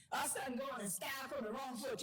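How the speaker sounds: Gullah Geechee speech. Shown as a demonstration. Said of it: "talk like this".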